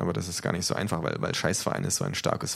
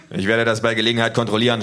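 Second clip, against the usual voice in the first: loud, higher, huffy threat; snorting indignation; 4 semitones higher, 7 dB louder, faster than usual. Acted. Official threatening a check, loudly huffy. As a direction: huff it out with raised voice. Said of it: "schnaubt der Mann".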